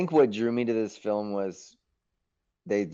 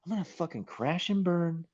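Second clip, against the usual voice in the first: higher, half-laughing rallying cry to himself; fired-up self pep talk; 8 semitones higher than usual. Retold or imitated. Actor psyching himself up about the role, fired up and higher. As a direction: psych yourself up to take the risk.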